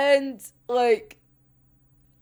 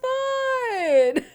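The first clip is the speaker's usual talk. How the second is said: high, slow, giggly gush; laughing through a giddy yes; gushing Lipman; 9 semitones higher, slower than usual. Retold or imitated. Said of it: "Lipman's just like".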